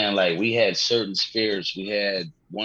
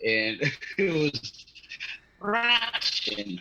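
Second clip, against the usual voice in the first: hammed-up Russian accent, whispered, pitch jumping high on Russian; mock Zangief Russian accent; 6 semitones higher, 3 dB quieter than usual. Podcast guest joking about his Russian accent, in mock Russian accent. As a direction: lay on a cartoon Russian accent through laughter. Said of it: "I sound like Zangief whenever I say Russian".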